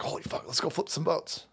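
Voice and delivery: conspiratorial. Retold or imitated. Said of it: "And so they're like".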